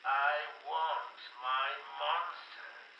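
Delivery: slow, drawn-out villainous demand; menacing demand. Acted. Joker demanding his monsters from Doc, slow menace.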